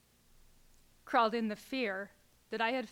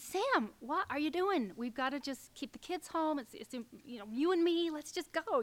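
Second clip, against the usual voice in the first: higher, pleading call to her husband; exasperated plea; 6 semitones higher than usual. Retold or imitated. Wife urging husband to take family trips, rising plea. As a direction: coax him to drop everything and go.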